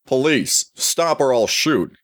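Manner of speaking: high-pitched police warning; mock shout without much volume; screamed megaphone warning. A narrator's reading. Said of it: "screamed a megaphone".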